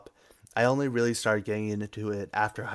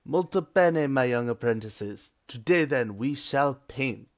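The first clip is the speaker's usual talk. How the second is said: put-on hammy Italian accent, exclamation pitched up; triumphant cheesy Italian master; 3 semitones higher, 3 dB louder than usual. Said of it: "That was about as cheesy as it gets".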